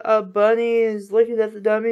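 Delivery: ordinary talk, nothing marked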